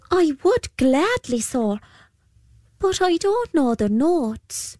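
put-on polite and timid, young lad; boy voice. A narrator's reading. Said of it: "said the boy".

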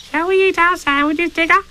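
tiny, very high squeaky youngster; high-pitched eager little voice; Roo's voice. Acted. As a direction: squeak the question like a curious little one. Roo asks Tigger.

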